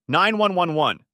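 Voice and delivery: phone operator. Acted.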